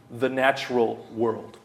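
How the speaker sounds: ordinary talk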